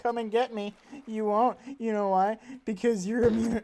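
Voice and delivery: taunting. Real, not acted. Taunting a mob in game.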